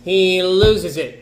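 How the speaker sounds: slow and loud storyteller emphasis; dramatic emphasis. A narrator's reading. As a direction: drag it out as the tension breaks. Narrator builds to host's outburst.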